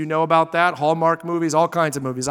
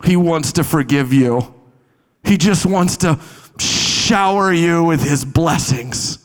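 a slow, gentle, lightly voiced loving message; mock tender prophet; 4 semitones lower than usual. Preacher imagining Jonah telling Nineveh God loves them, gently. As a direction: soften it as if promising blessings. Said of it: "he's gonna go".

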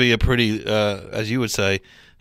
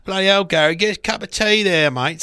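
loud, higher Cockney workman's call; Cockney worker; 6 semitones higher, 4 dB louder than usual. Retold or imitated. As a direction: bellow across the site in Cockney. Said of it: "the classic Cockney".

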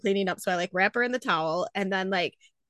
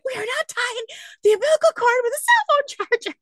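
pitched way up, scandalised; no way we're doing that; refusing the cell phone charger, high and outraged; high indignant refusal; 4 dB louder than usual. Retold or imitated. Refusing husband's idea.

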